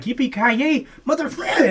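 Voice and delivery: big high shouted action-hero catchphrase; Die Hard catchphrase. Retold or imitated. Friend quoting the movie line, loud high shout. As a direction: yell it like John McClane, high and loud. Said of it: "he's like".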